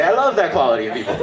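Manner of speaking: high, quick mock enthusiasm; gushing friends. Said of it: "And they say".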